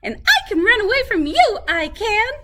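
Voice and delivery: very high, fast bragging; boasting gingerbread man. A narrator's reading. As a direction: rattle off the boast. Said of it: "said the gingerbread man".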